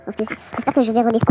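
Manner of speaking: usual speaking voice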